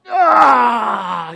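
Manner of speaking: loud drawn-out morning groan; waking-up groan. Acted. Preacher plays Jacob waking beside his bride, loud groan. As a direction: stretch awake with a big "ah".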